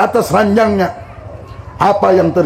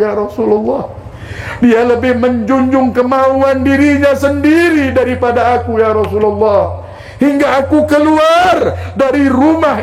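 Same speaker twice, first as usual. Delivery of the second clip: aggrieved mother; 6 semitones higher than usual. Acted. Mother complains of her son.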